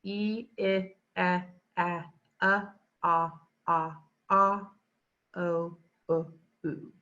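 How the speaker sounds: each vowel sound drawn out on its own; vowel valley sounds. Shown as a demonstration. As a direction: slide down the vowels and back up. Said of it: "but it starts like this".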